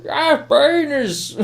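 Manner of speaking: very high, loud, drawn-out villain impression; Bane. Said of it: "Looking forward to Bane impressions".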